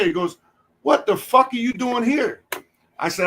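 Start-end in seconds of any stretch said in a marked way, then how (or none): 0.8-2.4 higher-pitched, delighted disbelief; surprised greeting. Retold or imitated. Wrestler greeting an old colleague he didn't expect, amazed. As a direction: act out amazement at an old friend turning up.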